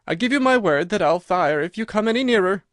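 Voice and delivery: high, loud, quivering threat; threaten, but scared to death; frightened cry. Acted. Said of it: "it cried".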